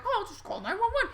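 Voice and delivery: high, fast mock-casual reply; breezy know-it-all reply, high and fast. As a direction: toss it off like it's easy. Mock-casual generic person.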